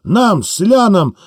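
rally the crowd, building up; rousing speech. Acted. Wounded soldier rousing villagers at the church, impassioned.

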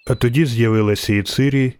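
ordinary delivery, nothing marked

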